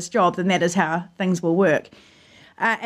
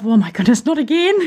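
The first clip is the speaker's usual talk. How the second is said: rising alarm, voice lifting high; exasperated disbelief; dismayed; 3 semitones higher, 4 dB louder than usual. Retold or imitated. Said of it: "I just thought to myself".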